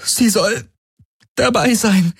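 high, strained words in labor; straining through contractions. A narrator's reading. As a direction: squeeze out each word. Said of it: "zwischen den Krämpfen".

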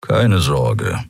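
understated low grumbling; grumbled reassurance. A narrator's reading. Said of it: "brummte er".

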